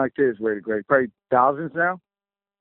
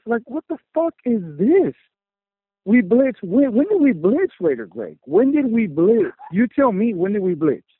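angry outburst; 6 semitones higher than usual. Real, not acted. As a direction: demand answers, fed up and shouting. Angry fan venting on hotline.

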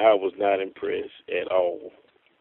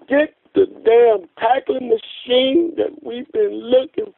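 excited draft-night outburst; 12 semitones higher than usual. Retold or imitated. Fan reliving the pick that raised his hopes, excited. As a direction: relive the thrill, pitch way up.

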